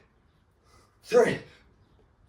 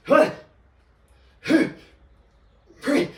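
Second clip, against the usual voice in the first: usual speaking voice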